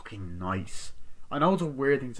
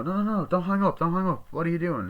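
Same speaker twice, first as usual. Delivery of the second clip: urgent plea; 8 semitones higher, 4 dB louder, faster than usual. Retold or imitated. Ghostface pleading not to hang up.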